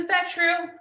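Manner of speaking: high, lifted question from mom; mom's gentle question. Retold or imitated. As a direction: ask it up high, curious and open. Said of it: "She said".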